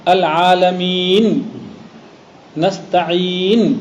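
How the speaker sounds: "العالمین، نستعین" drawn out slowly, each stopped on a sounded noon; waqf recitation demo. Shown as a demonstration. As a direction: stretch the final long vowel of "العالمین" and "نستعین", stop on noon. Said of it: "وقف کریں". ("العالمین، نستعین" کھینچ کر نون ساکن پر وقف)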